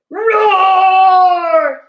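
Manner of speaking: Yeti roar. Acted. Yeti startles Bear, loud long roar. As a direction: let out a long beast roar.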